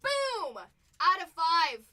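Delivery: loud, slow triumphant call; celebratory shout. Real, not acted. Announcing the rare card to chat, triumphant call. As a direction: call it out like a win.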